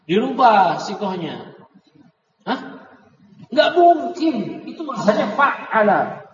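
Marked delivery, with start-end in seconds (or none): 3.5-4.6 high, slow Nggak mungkin; drawn-out impossible; emphatic denial. Real, not acted. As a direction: stretch out mungkin in disbelief. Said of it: "teacher insisting".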